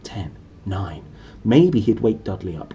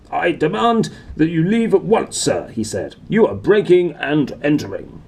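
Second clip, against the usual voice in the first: pompous demand; 6 semitones higher, 4 dB louder than usual. Acted. Vernon voiced demanding.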